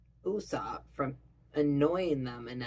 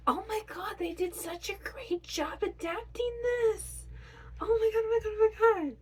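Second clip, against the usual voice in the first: fangirl squeal. Real, not acted. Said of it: "I am fan".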